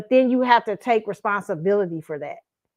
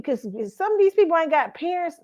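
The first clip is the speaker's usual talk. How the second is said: ordinary delivery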